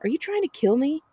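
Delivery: high, indignant accusation; Tally accusing her sister of nearly making her fall. A narrator's reading. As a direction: demand an answer, offended. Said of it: "glares at Nell accusingly".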